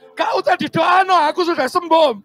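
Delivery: loud, high, frantic insistence; high frantic shout of being healed; shouting fake recovery. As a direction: yell that you're fine, panicking. Sick man pretends he is healed.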